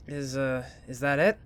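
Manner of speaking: normal talking voice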